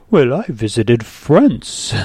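lightly voiced haughty customer, slow; a hint of a showy traveller, drawn out; snooty customer. Coffee-shop customer showing off her trip, lightly haughty. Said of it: "and she's like".

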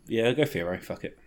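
normal talking voice

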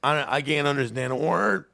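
high, breaking voice through tears; choked-up crying. Retold or imitated. Choking up to his wife at the concert, tearful. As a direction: sob it out like you can't help it. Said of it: "And I was like".

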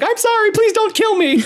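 very high, squealed frantic apology; hammed-up terror, pleading in a squeaky shriek; mock panicked scream. Bernie begs for her life in a mock panicked scream. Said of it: "screaming and like".

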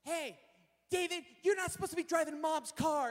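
high-pitched scolding little sister; mock little-sister telling-off. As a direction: tattle on a brother in a high kid voice. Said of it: "this is my little sister".

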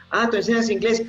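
high-pitched imitated friend; lightly voiced inquisitive friend; curious acquaintance. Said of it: "que me dicen".

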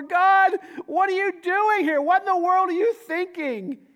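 high, loud, exasperated plea; exasperated cry to God. Retold or imitated. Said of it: "honest enough to say".

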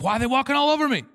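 high, loud, quick whine of protest; hammed-up aggrieved outburst; indignant complaint. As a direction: protest to God as if badly wronged. Believer complaining to God, acted.

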